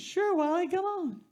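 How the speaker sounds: very high, sugary coaxing voice; sweet-talking mother. Acted. Mother coaxing her son out of the swamp, high sugary voice. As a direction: lure him out in a falsetto.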